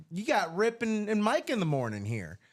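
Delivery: usual speaking voice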